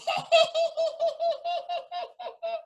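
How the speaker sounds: high cackle fading off; witch cackle. Acted. Witch cackling as she leaves the frog, high.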